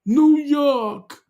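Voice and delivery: high, loud hammed-up New Yawk; mock American accent; mock New York accent again. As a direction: nail the New York twang. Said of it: "did I say it right, Jimmy?".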